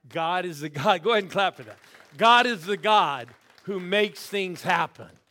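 loud, booming preacher proclamation; preaching at full force. Real, not acted. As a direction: proclaim it to the whole church.

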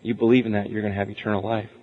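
low, soft, steady voice of God; calm voice of God. Retold or imitated. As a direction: speak as God, calm and sure. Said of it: "And God goes".

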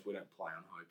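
low, soft coach impression; quiet mock coach. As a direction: mutter it like a terse coach. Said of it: "And he goes".

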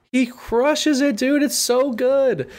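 high, slow, gushing delight; excited. Real, not acted. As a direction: stretch it out, thrilled.

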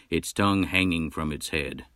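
usual speaking voice

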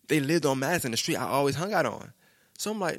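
ordinary delivery

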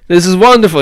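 put-on high, loud Israeli accent. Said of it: "you know, in an Israeli accent".

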